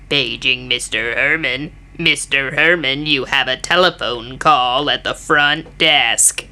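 low, slow hotel paging voice; deadpan public address announcement; flat echoey pa page. Retold or imitated. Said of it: "the voice that he has to lip sync to goes".

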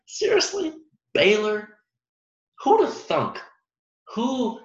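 high, slowed mock wonder; amazed disbelief. Real, not acted. Vlogger marvels at Baylor's unbeaten run, in high amazed voice. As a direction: marvel at it like it's impossible.

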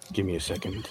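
soft, muttered aside. Acted. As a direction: hold up a moment, quietly. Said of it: "turns around to me and goes".

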